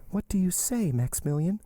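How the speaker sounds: put-on high-pitched young woman's question; distressed Valentine. Valentine questioning Maximilian, distressed and high. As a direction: lift the voice high for the heroine.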